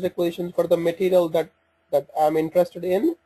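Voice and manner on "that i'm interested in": ordinary talk, nothing marked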